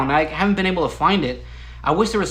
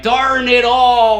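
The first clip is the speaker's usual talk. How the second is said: "Darn it all" stretched slow, higher and louder like a cheesy ad actor; milk "Darn it all" as an over-the-top sitcom groan, slow and loud; hammed-up disappointment; 5 semitones higher, 6 dB louder, much slower than usual. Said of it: "everybody loved the way I said".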